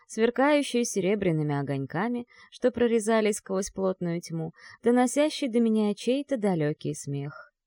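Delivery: ordinary delivery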